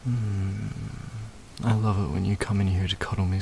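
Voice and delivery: ordinary talk, nothing marked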